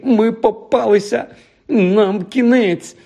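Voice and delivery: високий розпачливий зойк; заскімли високо, наче все пропало; скімлення. Acted. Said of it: "заскімлив Рон". (скімлить у відчаї)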